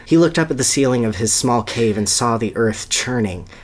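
normal talking voice

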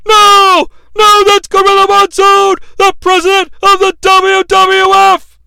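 high, loud anguished shout; shattered Vince. Retold or imitated. Announcer seeing the president flattened, anguished shout. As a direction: shout it heartbroken. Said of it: "Vince McMahon is shattered".

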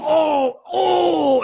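high, drawn-out "oh" howl; mocking howl. Troll howling at scammed contestants, jeering. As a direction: howl in mock agony. Acting Sam's jeering howls.

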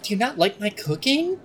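gasp, then high wounded question; affronted cook. Acted. Cook asks coffee drinker about his cooking, high and affronted. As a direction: play a halfling cook aghast at the slight.

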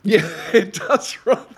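amused and laughing; laughing agreement. Real, not acted. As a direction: agree through a real laugh. Amused agreement with co-host's remark.

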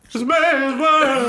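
high and excited through laughter; laughing. Real, not acted. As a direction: shout it out while cracking up. Hyped while laughing.

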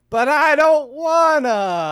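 loud, high, drawn-out whine; hammed-up reluctant bawling; mock childish whine. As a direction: wail it like a kid refusing. Mocks reluctant recruit whining.

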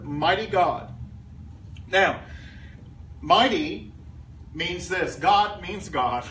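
ordinary talk

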